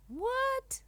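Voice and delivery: high, startled squeal of disbelief; shocked cry. A narrator's reading. Dragon hears she is an ugly monster, shocked cry. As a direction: jolt up in sheer shock. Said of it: "cries Dragon".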